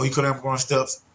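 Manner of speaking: normal talking voice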